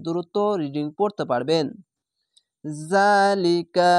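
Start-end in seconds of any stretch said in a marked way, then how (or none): 2.9-3.9 জালিকা drawn out slowly, the long a held; চার্টে টেনে পড়া জালিকা; stretched vowel. Shown as a demonstration. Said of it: "এক আলিফ টেনে পড়বেন". (টেনে টেনে ধীরে পড়া)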